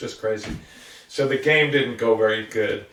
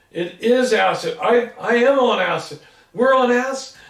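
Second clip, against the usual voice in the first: mounting alarm that it's acid; excited realization; 8 semitones higher than usual. Retold or imitated. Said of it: "another kid goes".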